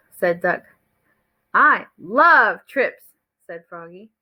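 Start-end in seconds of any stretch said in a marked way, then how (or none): 1.5-3.0 love stretched long and punched; stress on love. A narrator's reading. Froggy answers Duck about the trip, heavy emphasis on love. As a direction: give love extra power, drawing it out. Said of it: "with more emphasis in our voice".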